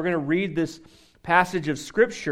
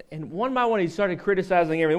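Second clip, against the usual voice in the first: ordinary delivery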